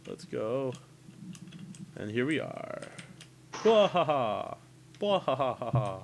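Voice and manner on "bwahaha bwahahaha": hammed-up villain cackle; evil laugh. Evil laugh before battle.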